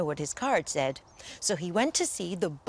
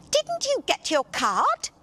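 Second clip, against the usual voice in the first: surprised fairy; 11 semitones higher, 4 dB louder than usual. Acted.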